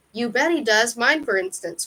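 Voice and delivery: ordinary delivery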